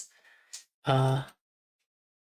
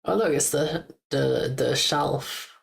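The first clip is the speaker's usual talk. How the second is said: normal talking voice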